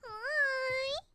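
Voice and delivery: high, soft cooing. Real, not acted. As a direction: coo sweetly at the chubby cat. Talking to her cat.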